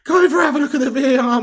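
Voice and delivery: high, loud, fast urgent plea; pleading with the referee. Real, not acted. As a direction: yell at the ref to check VAR.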